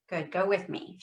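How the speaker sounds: ordinary talk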